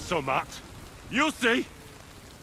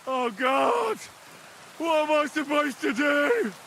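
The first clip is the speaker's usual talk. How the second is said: screaming while falling; 7 semitones higher than usual. Acted. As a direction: panic as he slips and falls.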